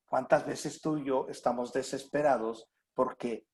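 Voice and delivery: usual speaking voice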